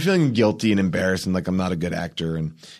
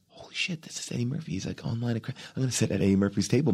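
much softer, breathy starstruck whisper; excited whisper; 2 semitones higher, 8 dB quieter, faster than usual. Retold or imitated. Actor spotting Eddie Murphy at lunch, whispering excitedly. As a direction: hush it like a secret thrill.

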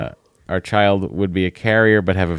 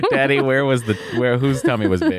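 put-on child voice; 6 semitones higher than usual. Voicing a kid's question.

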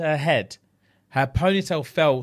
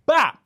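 sharp imitated slap; smack sound; 9 semitones higher, 6 dB louder than usual. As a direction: say it like a quick hard slap.

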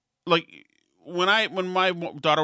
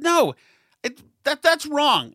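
much higher, louder protest; heated denial; 11 semitones higher, 4 dB louder, slower than usual. Retold or imitated. Father shouting back at the woman, higher and louder. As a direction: cut her off hard. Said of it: "I said".

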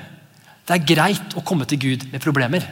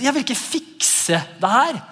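high, drawn-out mock protest; defensive; 10 semitones higher, slower than usual. Preacher acts out refusing to fix the wound, defensive. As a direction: dig your heels in, pitched up and slow. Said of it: "da får vi sånn defense".

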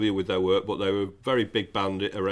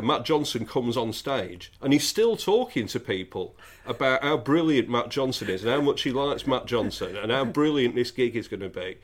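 ordinary talk

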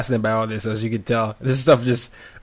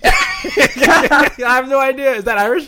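high-pitched, laughing disbelief; laughing bafflement; 11 semitones higher, 4 dB louder than usual. Real, not acted. Podcast host hears an Irish phrase she doesn't know, laughing bafflement. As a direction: burst out laughing, completely lost.

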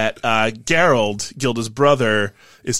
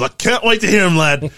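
chuckling, hearty character voice, higher and louder; Gerald; 7 semitones higher, 4 dB louder than usual. Acted. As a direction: boom it out with jovial anticipation. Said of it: "Gerald chuckles and he goes".

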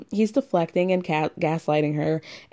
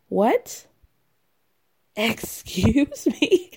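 higher, slow, incredulous with a laugh; scandalised, can't believe it; indignant disbelief; 4 semitones higher, much slower than usual. Real, not acted. Host to listeners, shocked at his question. Outraged reaction to rude question.

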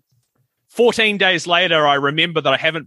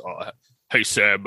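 deep radio announcer voice; 7 semitones lower, 5 dB quieter, slower than usual. Retold or imitated. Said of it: "a really deep radio announcer's voice".

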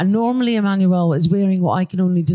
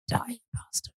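hushed muttered curse; whispered curse; 4 semitones higher, 14 dB quieter than usual. Retold or imitated. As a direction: whisper it under your breath. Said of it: "I'm just going".

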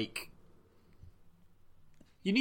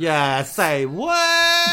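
drawn-out sassy quote; 5 semitones higher, 9 dB louder, much slower than usual. Retold or imitated. Echoing sassy drag queen line.

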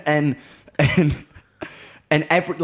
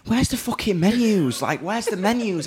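mock hysterical; 7 semitones higher than usual. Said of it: "just turning hysterical".

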